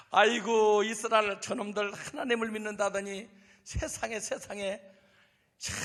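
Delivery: lightly voiced scornful sneer; Goliath sneering at the boy David; mocking giant. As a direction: scoff at the tiny challenger. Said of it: "껄껄 웃어요".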